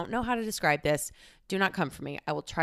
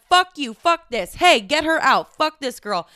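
put-on drunken yelling; 7 semitones higher, 7 dB louder than usual. Drunk bottle girl shouting to get the guest kicked out. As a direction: yell like a drunk causing a scene.

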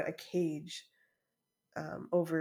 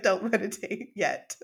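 laughing quote; 3 semitones higher, 7 dB louder than usual. Retold or imitated. Staff voiced, laughing.

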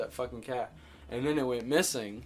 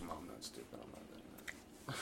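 softer, lower mock mutter; muttered retort; 7 semitones lower, 15 dB quieter than usual. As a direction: mumble the comeback half to yourself. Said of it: "I'm like".

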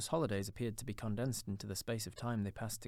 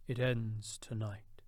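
ghost's reply; much slower than usual. A narrator's reading. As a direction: intone like an ageing spirit. Ghost speaks.

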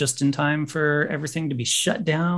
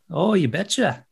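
put-on Minnesota accent; 5 semitones higher than usual. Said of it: "like, a Minnesota".

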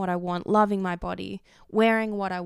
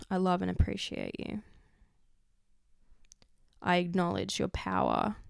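guided visualization. Acted. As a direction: guide the listener gently inward. Leads a visualization.

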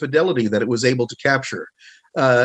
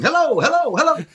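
high ringing hello hello to test the room; acoustics-test hellos; 12 semitones higher than usual. Retold or imitated. Imitating Marvin testing the room, high calling hellos. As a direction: call out high to hear the echo. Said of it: "He just suddenly starts going".

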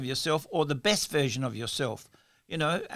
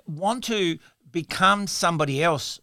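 ordinary delivery

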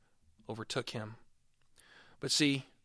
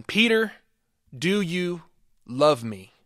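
high, louder pleading question; Jesus asking; 3 semitones higher, 6 dB louder, slower than usual. Acted. Jesus asking Peter the third time, high and urgent. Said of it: "Third time".

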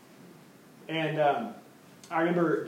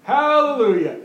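drawn-out, high, loud hallelujah calls; happy churchgoer praising aloud, slow and high; imitating his hallelujahs; 8 semitones higher, 7 dB louder, much slower than usual. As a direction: stretch each word out like a joyful shout. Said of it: "he would constantly be walking around going".

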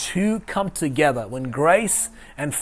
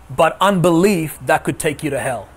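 usual speaking voice

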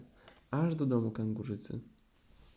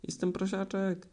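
much higher desperate piglet plea; piglet shouting; 8 semitones higher, slower than usual. A narrator's reading. Piglet in the tub begs Kanga, very high squeal. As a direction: squeal to be let go. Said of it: "krzyczał prosiaczek".

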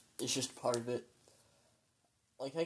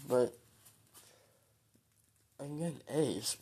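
normal talking voice

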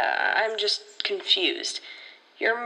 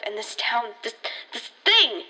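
usual speaking voice

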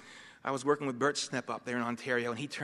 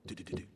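soft tapping noise; 10 dB quieter, much faster than usual. Retold or imitated. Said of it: "he'd go".

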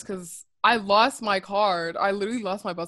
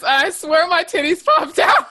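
loud, high, slowed by laughter; laughing confession; 7 semitones higher, 7 dB louder, slower than usual. Real, not acted. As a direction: blurt it out through a laugh. Laughing confession of embarrassing mishap.

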